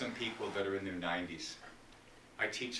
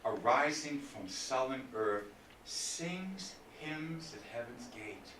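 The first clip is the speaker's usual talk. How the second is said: slow, measured poetry reading that sinks softer; sonnet recitation; 5 dB quieter, slower than usual. Acted. Teacher reciting Shakespeare to her seminar, slow and measured. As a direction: read the verse slowly, savouring each line.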